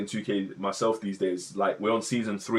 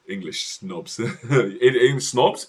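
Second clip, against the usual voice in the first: ordinary talk, nothing marked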